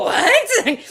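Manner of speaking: high, loud incredulous cry; retold shock. Reacting to news of the rake, hammed-up shock.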